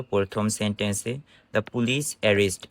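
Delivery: ordinary talk, nothing marked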